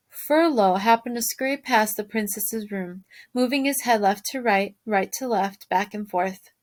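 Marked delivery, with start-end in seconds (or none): none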